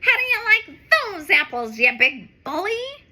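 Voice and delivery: loud taunting cat; mock the bully from above; calling down. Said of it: "Streaky calls down".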